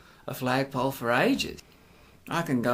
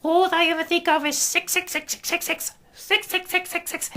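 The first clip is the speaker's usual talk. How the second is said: high, loud shrill-free mock of prim old biddies; mocking prudish old ladies; 4 dB louder than usual. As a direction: imitate a scandalised blue-rinse lady, much higher. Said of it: "their blue rinse knitting set ... going".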